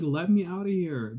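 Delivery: loud, high, insistent rant; indignant demand. Acted. Inmate arguing over the buzzer for release, indignant. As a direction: argue loudly, leaning hard on key words.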